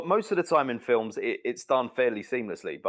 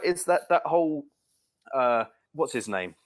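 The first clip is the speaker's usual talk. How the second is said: usual speaking voice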